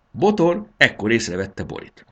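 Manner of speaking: usual speaking voice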